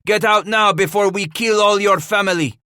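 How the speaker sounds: high, loud threatening call; shouted warning. A narrator's reading. Henri warns the matriarch to flee, shouting. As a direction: shout the ultimatum across to her.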